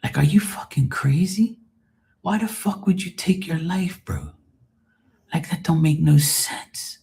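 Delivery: hushed, stunned whisper; whispered disbelief. Retold or imitated. To his friend who took his life, a stunned whisper. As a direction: whisper it like you can't believe it. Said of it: "I'm like".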